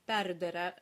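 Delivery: perdere with unaccented E's turned to "uh"; schwa endings. Shown as a demonstration. Teacher showing an extreme dialect case, perdere with uh endings. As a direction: say perdere the southern way, last vowels slack as schwas. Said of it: "the unaccented E's turn into schwas or uh sounds".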